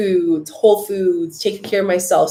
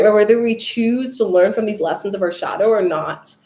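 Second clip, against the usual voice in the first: usual speaking voice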